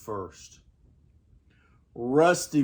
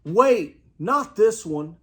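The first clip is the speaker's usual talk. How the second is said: shouted; 6 semitones higher than usual. A narrator's reading. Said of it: "shouted Lou".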